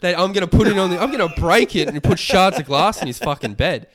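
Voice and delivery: high, loud, outraged outburst; real fuming rant. Real, not acted. Ranting at housemates over the plate, fuming. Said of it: "This was two years ago and I'm fuming".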